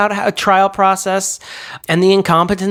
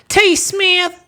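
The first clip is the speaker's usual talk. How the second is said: squeaky high voice; 11 semitones higher than usual. Pretend. Patron credits in silly voices.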